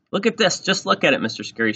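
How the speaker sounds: high, loud indignant complaint; grouchy kid's loud whine of protest. A narrator's reading. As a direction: demand that the teacher look. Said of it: "she grouched".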